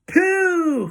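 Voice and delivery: very high, loud hoot of disgust; audience cry. A narrator's reading. Audience jeering at children's smell, high loud cry. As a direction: hoot it high and long, falling at the end. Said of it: "cried the audience".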